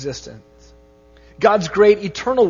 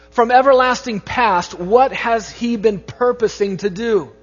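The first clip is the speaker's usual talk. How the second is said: louder, higher preaching climax; rising preaching; 3 semitones higher, 4 dB louder than usual. Real, not acted. Pastor stirring the congregation, louder and higher preaching. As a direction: build the questions to full force.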